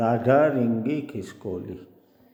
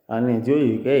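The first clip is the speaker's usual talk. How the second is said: usual speaking voice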